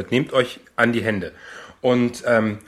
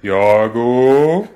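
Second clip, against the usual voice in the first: loud, drawn-out hypnotist call; slow hypnotist voice; 7 dB louder than usual. Pretend. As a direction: summon him like a stage hypnotist. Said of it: "Jetzt zurück".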